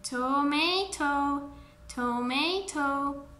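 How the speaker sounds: "tomato" stretched slowly, to-MAY-to; pronunciation model. Shown as a demonstration. Teacher reminding children who forgot, "tomato" slowly modelled. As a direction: say "tomato" slowly, every syllable drawn out. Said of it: "ya sabemos que se dice tomato".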